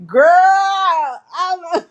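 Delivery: laughing out loud, high and loud. Real, not acted. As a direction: break into a real laugh. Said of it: "that made me chuckle".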